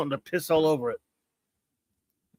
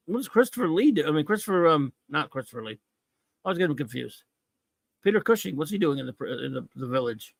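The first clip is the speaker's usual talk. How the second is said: usual speaking voice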